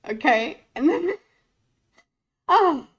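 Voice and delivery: usual speaking voice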